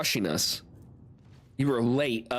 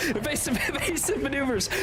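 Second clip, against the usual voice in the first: laughing mock panic; 12 semitones higher than usual. Ship under attack, mock panic.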